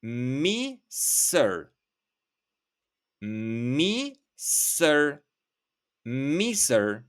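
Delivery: mi sir, set apart and said clearly; word demo. Shown as a demonstration. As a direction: model mi sir carefully for the class. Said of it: "you can say".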